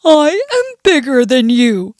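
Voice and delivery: boastful storybook bear voice; put-on bear, mildly annoyed. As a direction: throw your weight around as a bear. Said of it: "Mister Bear is annoyed".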